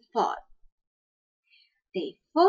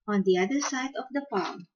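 plain reading voice

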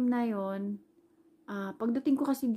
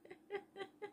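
quiet, high giggle; soft little run of high chuckles; amused laugh; 12 semitones higher, 16 dB quieter than usual. Real, not acted. Amused at failed excuse.

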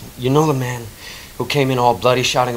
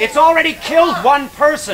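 high, loud urgent warning; urgent shout; 10 semitones higher, 6 dB louder than usual. Acted. Dave warns the shoppers of the creature, urgent shout. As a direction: shout to make them believe. Warning crowd about monster.

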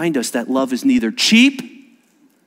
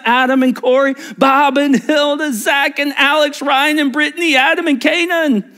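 rising louder and higher through the names; building list; 10 semitones higher, 5 dB louder than usual. Acted. Pastor calling out couples, building excitement. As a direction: build the list up like a roll call.

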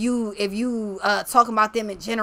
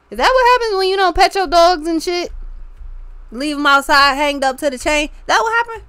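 much higher, louder, teasing questions; mocking teasing voice; 8 semitones higher, 8 dB louder than usual. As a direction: needle the dog with high, loud mock questions.

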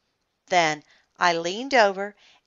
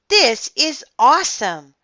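higher, louder burst of admiration; excited; 5 semitones higher, 5 dB louder than usual. A narrator's reading. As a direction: gush to an impressed friend. Kid admires friend's sandcastle.

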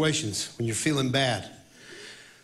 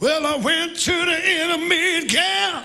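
high, loud, slow shouted worship line; full-force shout; 6 dB louder, slower than usual. Shown as a demonstration. Worship leader repenting all the way, shouting the song. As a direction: belt it with everything. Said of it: "some of us would have went out there".

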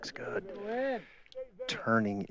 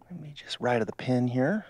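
golf commentator; 2 semitones higher, 4 dB louder than usual. Acted.

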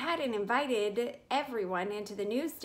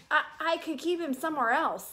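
put-on pleading boy; 7 semitones higher, 5 dB louder than usual. Boy pleads with his mother.

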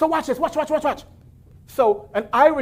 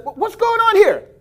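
surprised; 11 semitones higher than usual. Pretend. Preacher voicing imagined worshiper.

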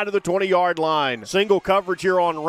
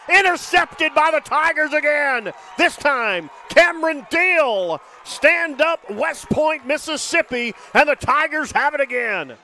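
excited shout; 5 semitones higher than usual. Real, not acted. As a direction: call the crowd to stand. Interception, crowd rallying call.

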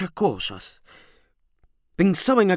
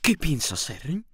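softer, lowered voice; low voice; 2 semitones lower, 5 dB quieter than usual. A narrator's reading. Landlady questioning her brooding lodger, in a low voice. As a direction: ask it under your breath. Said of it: "le dije en voz baja".

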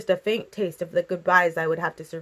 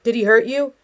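raised, louder demand; edging toward anger; 4 semitones higher, 6 dB louder than usual. A narrator's reading. Father asking whether his daughter was hurt, angry edge. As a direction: question with a touch of anger. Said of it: "His tone edged toward anger".

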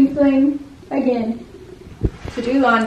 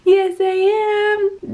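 put-on baby talk; 7 semitones higher, much slower than usual.

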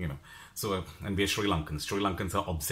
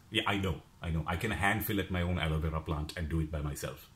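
usual speaking voice

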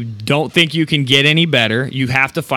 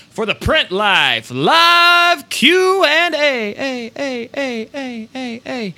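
hammed-up announcer call, higher and slow; show announcer; 10 semitones higher, much slower than usual. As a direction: sing out the segment title like a jingle. Announcing the Q and A segment.

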